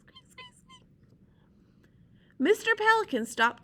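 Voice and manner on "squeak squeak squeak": very high, whispered breathless squeaks. Shown as a demonstration. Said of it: "angry squeaky sound".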